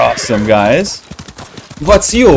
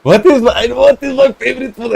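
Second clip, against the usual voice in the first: much higher, hammed-up baffled voice; mock stunned high voice; 11 semitones higher than usual. Streamer repeating a chat question in a mock baffled voice. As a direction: pretend to be stumped by a simple question.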